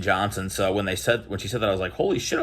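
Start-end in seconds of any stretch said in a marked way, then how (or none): 1.9-2.4 much higher retold holy shit; imitating his stunned reaction; retold amazement. He recalls learning the cashier's niece is a star, stunned. Realizing niece is Raven Johnson.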